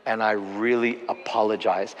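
humble and sincere, voice sunk low; sorry to the bone, softly and slowly; humble apology. Retold or imitated. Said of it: "moment of vulnerable humility".

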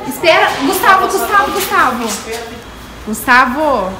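loud call across the yard. Real, not acted. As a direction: mom calling her son's name. Calling son to see car.

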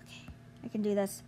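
soft unvoiced self encouragement; whispered pep talk. Real, not acted. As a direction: whisper to steady yourself.

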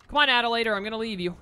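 loud impatient call. Real, not acted. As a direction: call her over, loudly. Calling companion to hurry.

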